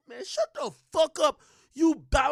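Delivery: loud, high-pitched acted outburst; mock angry comeback. Man firing back at insults, mock angry. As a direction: burst out as if fed up.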